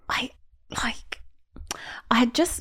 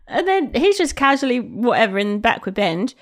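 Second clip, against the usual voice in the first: normal talking voice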